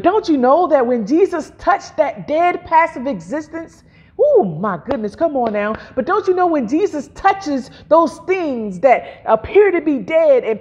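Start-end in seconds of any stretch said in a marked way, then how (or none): none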